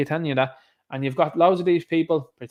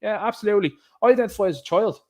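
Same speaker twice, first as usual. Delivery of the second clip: higher, breezy mock official waving people through; mock eager official; 6 semitones higher than usual. Host mocking officials who wave everyone through, higher and breezy. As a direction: lightly send up an eager pushover official. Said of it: "our clown show will go".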